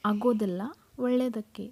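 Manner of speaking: usual speaking voice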